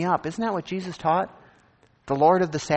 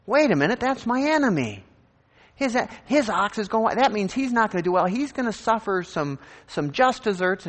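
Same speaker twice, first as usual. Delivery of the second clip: indignant objection; 6 semitones higher than usual. Retold or imitated. A listener objects to helping his enemy, mock indignant. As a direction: play the outraged believer who won't help his enemy.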